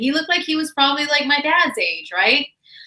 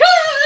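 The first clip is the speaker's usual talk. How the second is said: much higher, loud shriek of fright; startled scream; 7 dB louder than usual. Retold or imitated. The stalker calls the restaurant and she shrieks in fright. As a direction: shriek as if you'd touched a snake.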